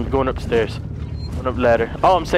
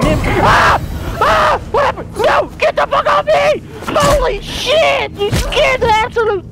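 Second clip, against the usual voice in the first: much higher, louder panicked shrieks; terrified screaming; 4 dB louder than usual. Real, not acted. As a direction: scream as if grabbed from behind. Android jumps on him.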